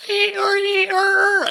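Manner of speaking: very high imitated creature cry; dragon screech. Podcaster imitating the dragon overhead, high screech. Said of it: "of course you hear, like".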